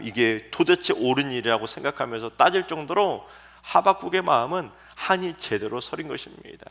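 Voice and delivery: normal talking voice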